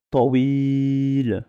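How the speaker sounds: "Tawil" with an open o, to-wil; the corrected Arabic word "Tawil"; model tawil. Shown as a demonstration. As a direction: say "Tawil" as "tɔwil". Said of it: "Et j'ai pas dit tawil".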